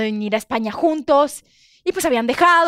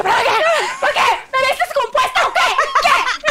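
hysterical; 12 semitones higher than usual. Retold or imitated. Woman asked to come inside, hysterical. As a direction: fire off frantic questions. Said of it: "yo histérica".

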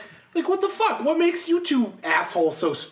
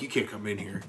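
low, soft imitation of the guard; mock quiet old guy; 10 semitones lower, 9 dB quieter than usual. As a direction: drone it like a dull doorman. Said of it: "They're like".

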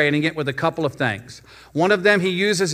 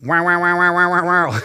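engine cranking noise. Retold or imitated. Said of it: "you can help me out".

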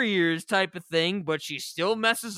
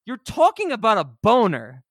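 mock exasperated; 6 semitones higher, 5 dB louder than usual. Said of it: "And I'm like".

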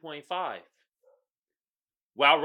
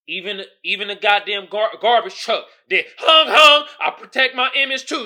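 rising, louder and higher rant; heated rant; 5 semitones higher, 11 dB louder than usual. Real, not acted. Ranting to viewers, getting louder and higher. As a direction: work yourself up into a holler.

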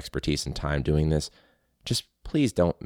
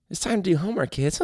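a high, weary toy-bear voice; a bored talking teddy bear calling the kids; tired teddy teacher; jaded Teddy Ruxpin; 12 semitones higher than usual. Retold or imitated. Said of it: "jaded Teddy Ruxpin".

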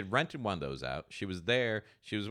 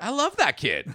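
mock affectionate retort; 11 semitones higher, 6 dB louder than usual. Retorting to a friend.

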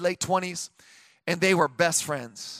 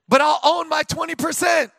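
higher, loud declaration; preacher's shout; 7 semitones higher, 3 dB louder than usual. Real, not acted. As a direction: belt it out to the congregation. Shouted declaration after muttered thought.

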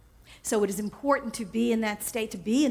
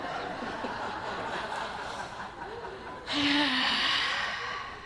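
breathy laugh; 3 semitones higher, 6 dB quieter than usual. Real, not acted. Laughing after the pterodactyl joke.

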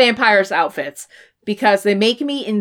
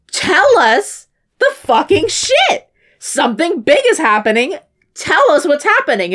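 high, exasperated demand; hammed-up confrontational yell; yelling at dad; 6 semitones higher than usual. Son demanding answers from his dad, high acted yelling. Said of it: "yells at his fucking dad".

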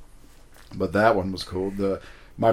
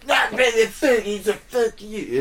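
mock heckler voice; 13 semitones higher, slower than usual. Mocking the heckler flipping him off.

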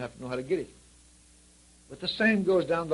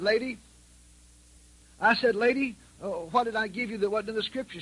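high, pressing challenge; raised voice; 8 semitones higher than usual. Retold or imitated. Preacher challenging the widow, high and insistent. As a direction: press her hard, voice up high.

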